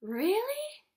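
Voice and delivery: gasp, then a high, loud questioning "really?"; shocked. Acted. Acting the shocked animals for a child, exaggerated surprise. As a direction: act out open shock. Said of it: "They look shocked. Like".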